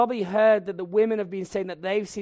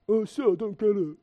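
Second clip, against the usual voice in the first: dim pupil; 4 semitones higher, 4 dB louder than usual. Retold or imitated. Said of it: "ask the really stupid questions".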